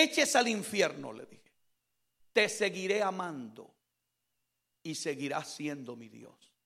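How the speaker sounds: low, very soft, subdued testimony; testimonio íntimo. Retold or imitated. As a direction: recount a private prayer in a hushed, low voice. Subdued testimony of talking with God. (testimonio en voz baja, grave y recogida)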